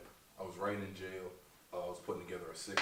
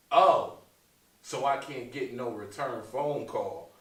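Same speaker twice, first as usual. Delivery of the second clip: a lightly mocked aunt, higher and louder on the oh; imitating aunt; 4 semitones higher, 11 dB louder, slower than usual. Comedian mimicking an aunt who wants a call back. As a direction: mimic the aunt's complaint, drawn out. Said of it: "my aunt called me and said".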